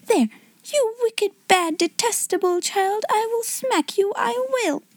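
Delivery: very high-pitched flustered threat; high-voiced tirade without extra volume; helpless, excited scolding. A narrator's reading. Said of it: "quite so helplessly and excitedly".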